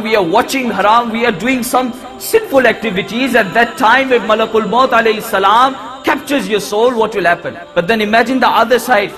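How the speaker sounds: ordinary talk, nothing marked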